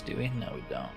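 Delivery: ordinary talk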